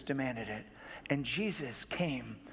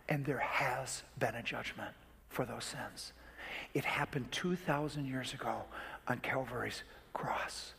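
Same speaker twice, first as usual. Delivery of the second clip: hushed comfort; 3 semitones lower, 6 dB quieter than usual. Retold or imitated. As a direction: whisper forgiveness to a weeping woman. Pastor whispers comfort to Rachel.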